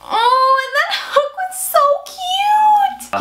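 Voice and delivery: very high, stretched-out squealing coo; gushing coo. Real, not acted. Fan gushing over cute Hook, cooing. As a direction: melt over how adorable he is, slow and squeaky.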